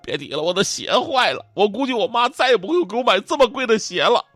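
hammed-up distressed wail, pitched high; mock anguish. Schoolkid telling deskmate his shoes are ruined, in mock anguish. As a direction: lament it like a heartbroken schoolkid. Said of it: "痛苦啊".

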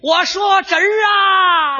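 high, very stretched-out call. Acted. Soldier claiming kinship across the lines, calling out. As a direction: holler it with long drawn vowels.

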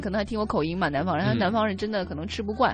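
mild hint of a kind Beijing auntie; patient auntie. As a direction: gently talk a confused southerner round. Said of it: "她就非常有耐心".

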